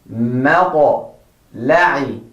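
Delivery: مطلع spoken slowly with a clear ta; slow model word. Shown as a demonstration. Teacher slowly models مطلع for the class. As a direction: sound out مطلع slowly, each letter clean. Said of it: "The next word is مطلع".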